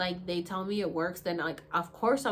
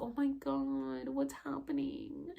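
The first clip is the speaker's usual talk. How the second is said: higher, softer, slow alarmed whisper-like gasp; alarmed hush; 6 semitones higher, 7 dB quieter, slower than usual. Retold or imitated. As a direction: ask it stunned and hushed. Shocked at man bawling.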